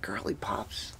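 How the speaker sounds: breathless whisper of disbelief; dismayed whisper. Retold or imitated. Whispering in dismay at the director's request. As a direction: whisper it like you can't believe it. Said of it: "I'm like".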